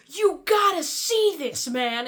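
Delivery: high, loud, slow and eager; hammed-up thrill at a discovery; excited call. Anya urging Javier to look in the mirror, high excited call. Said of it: "looks at him and goes".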